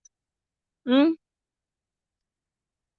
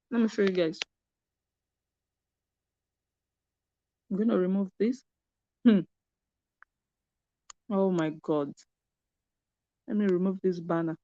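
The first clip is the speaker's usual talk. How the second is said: chewing while talking; eating crunchy fried chicken; 2 semitones lower, 8 dB quieter, faster than usual. Real, not acted. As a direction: mouth full of chicken. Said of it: "I'm eating chicken".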